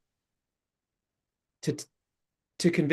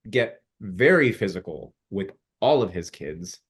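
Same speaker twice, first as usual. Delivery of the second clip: usual speaking voice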